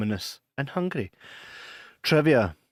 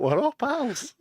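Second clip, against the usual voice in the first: mock innocent; 11 semitones higher, slower than usual. Innocent defence of the joke.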